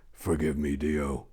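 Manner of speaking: soft, low murmured plea; whisper. Acted. Knight praying as he lights the pyre, softly. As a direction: beg forgiveness under the breath. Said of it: "He whispered".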